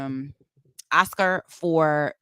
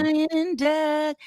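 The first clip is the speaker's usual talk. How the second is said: rapper voice; 10 semitones higher than usual. Pretend. Calling cohost in rap voice.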